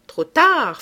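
high, loud gloating retort; riposte triomphante. A narrator's reading. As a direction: cut off the last hope loudly. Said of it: "Reste la fenêtre, cria Beautrelet". (réplique triomphante, voix haute et forte)

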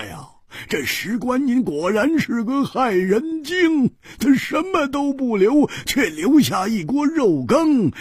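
excited shout. Acted. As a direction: blurt it out high and thrilled. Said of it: "大叫". (胡铁花高声兴奋大叫)

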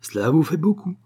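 excitable yapping woman; yelped retort. A narrator's reading. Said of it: "glapit madame Gordeloup".

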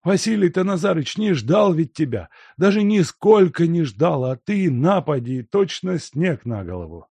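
put-on high, loud grumbling old man; reproachful old servant. A narrator's reading. Servant chiding the guest, high and reproachful. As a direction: chide him for turning up unannounced.

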